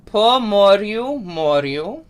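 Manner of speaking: "po moryu, moryu" slowly, with rolled R's; Ukrainian pronunciation. Shown as a demonstration. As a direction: model "moryu" slowly, rising at the end. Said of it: "let's do that one more time".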